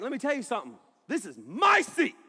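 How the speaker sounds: high, loud confrontational outburst, slowing on the last phrase; angry Ryan. Retold or imitated. Friend confronts a security guy over his seat, angry shout. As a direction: build to a hammed-up furious shout. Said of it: "Angry Ryan".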